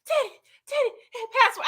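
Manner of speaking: high, frantic mock pleading; desperate Franklin. Franklin begging dying Teddy for the password, high frantic plea. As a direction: shake him awake, panicking. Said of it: "He over there with Dan".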